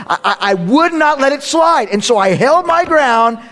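loud, high-pitched indignation; comically worked-up insistence; mock outrage. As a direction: protest loudly, as if still refusing to back down. Mock outrage over cheating at checkers.

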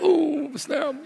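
high, quiet comic wince; mock shock at being called out; small high-pitched stung coo; stung reaction. Comic reaction to son's prayer.

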